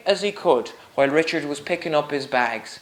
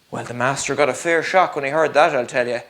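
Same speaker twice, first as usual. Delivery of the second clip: ordinary delivery, nothing marked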